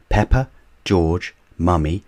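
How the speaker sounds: ordinary delivery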